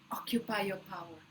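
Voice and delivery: usual speaking voice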